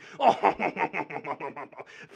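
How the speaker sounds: stuttering, drawn-out mock laugh; fake laugh. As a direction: laugh fakely like a smug snob. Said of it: "Your fake laughter".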